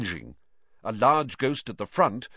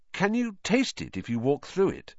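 put-on higher-pitched, questioning boy's voice; curious boy; 8 semitones higher than usual. A narrator's reading. Harry asking a ghost about rotten food, curious.

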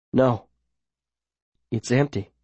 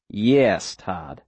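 lightly voiced unruffled mayor, dropping low; calm villain; 4 semitones lower than usual. A narrator's reading. Mayor to the horrified boy, calm and low.

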